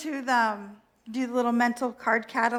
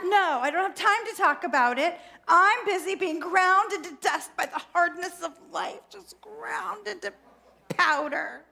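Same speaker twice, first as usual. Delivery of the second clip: higher, melodramatic whining tantrum; 5 semitones higher than usual. Acted. Overwhelmed self refuses God's offer, whining tantrum. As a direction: throw a self-pitying little tantrum. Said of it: "So not the point in my tantrum".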